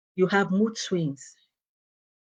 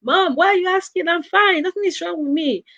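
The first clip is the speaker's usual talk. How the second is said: much higher, louder annoyed daughter; exasperated teen; 12 semitones higher, 5 dB louder than usual. Retold or imitated. Teen daughter brushing off her worried mom, high and exasperated. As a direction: whine it like a fed-up teenager, pitched way up.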